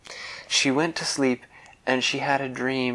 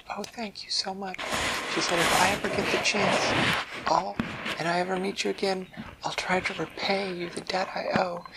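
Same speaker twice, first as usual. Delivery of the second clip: higher, hushed thanks; grateful fish; 8 semitones higher than usual. Acted. Fish swimming off thanking the fairy, higher. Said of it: "Ramuna swam off and said".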